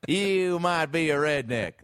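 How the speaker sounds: mock Southern stand-up cadence; Jeff Foxworthy. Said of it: "that's my impression of those jokes".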